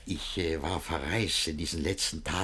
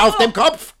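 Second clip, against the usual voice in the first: higher, louder rebuke; angry scold; 12 semitones higher, 12 dB louder than usual. Acted. Master scolding servant for his hat. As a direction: scold with rising fury.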